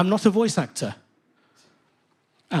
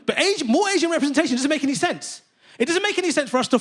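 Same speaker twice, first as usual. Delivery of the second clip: exasperated outburst; 6 semitones higher than usual. Acted. Comic outburst against forced representation.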